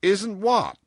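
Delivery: loud, high, abrupt question; demanding. A narrator's reading. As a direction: demand an answer at full voice. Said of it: "demanded John Dene".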